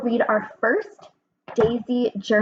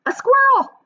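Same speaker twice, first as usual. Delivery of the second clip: much higher, louder eager little-flower voice; excited flower guess; 13 semitones higher, 5 dB louder than usual. Acted. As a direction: squeak out the guess like a curious flower. Flowers guessing the strange sound.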